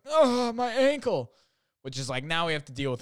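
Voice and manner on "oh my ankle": high, slow, hammed-up whine about an ankle; whiny ankle complaint. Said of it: "And she was like".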